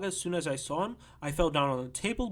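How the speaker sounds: ordinary delivery, nothing marked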